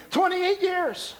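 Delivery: very high, slow mock correction; voiced church member. Preacher acts out member correcting himself, high and drawn-out.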